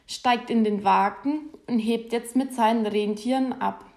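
plain reading voice

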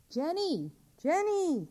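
calling a name out loud, rising. Acted. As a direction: call for the lost child down the street. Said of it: "she called out".

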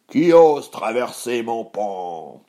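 loud, drawn-out troll growl; menacing challenge, loud and stretched; rumbling troll. Acted. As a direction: boom it slowly as a hungry troll. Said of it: "gronda le troll affamé".

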